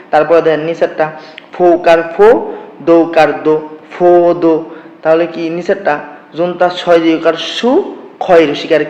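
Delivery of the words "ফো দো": "ফো দো" sounded out loudly as two syllables; syllable-by-syllable demo. Shown as a demonstration. Teacher spells out the next word for learners, syllable by syllable. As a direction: say "ফো", then "দো", each loud and separate. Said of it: "তাহলে উচ্চারণ কি হবে".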